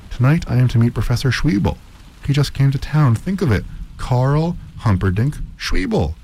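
high-pitched, thrilled announcement; excited awe. A narrator's reading. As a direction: share big news, tinged with awe. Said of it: "his voice full of excitement".

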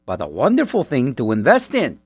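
ordinary delivery

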